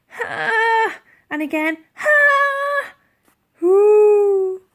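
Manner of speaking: high loud grunts of heaving; straining effort. Acted. Elsie straining to pull the mammoth out. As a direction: heave with all your might.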